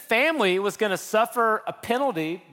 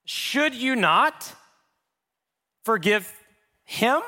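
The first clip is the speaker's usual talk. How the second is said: slow, high, emphatic challenge; pointed question; 6 semitones higher, slower than usual. Real, not acted. Preacher challenging the congregation, slow and emphatic. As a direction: press each word on the listeners.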